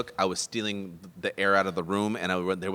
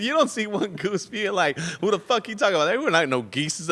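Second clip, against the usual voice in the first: laughing quote; 10 semitones higher, 3 dB louder than usual. Retold or imitated. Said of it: "He said".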